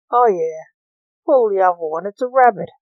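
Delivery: loud, mocking disbelief; narrated sceptical jeer, no real laugh; skeptical scoff. A narrator's reading. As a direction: scoff at it, lifting loudly at the end. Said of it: "Lance laughed skeptically".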